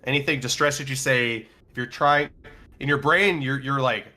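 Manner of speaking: usual speaking voice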